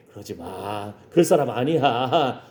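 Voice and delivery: gentle dissuading. Acted. Church lady calming her suspicious friend, gently dissuading. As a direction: drop low and talk her down.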